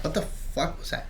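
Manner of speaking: hushed, breath-only reaction; soft, unvoiced startled question; spooked whisper. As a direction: whisper it as if someone might be up there. Retold spooked reaction to footsteps.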